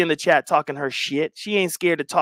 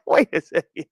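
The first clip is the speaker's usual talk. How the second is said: laughing; 11 semitones higher than usual. Real, not acted. Laughing at chat.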